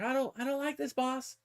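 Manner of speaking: high, small, soft creature voice; squeaky little grot; whining grot. Retold or imitated. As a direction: plead like a tiny homesick minion. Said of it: "the grot's like".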